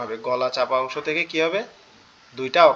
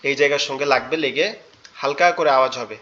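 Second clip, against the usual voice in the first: ordinary delivery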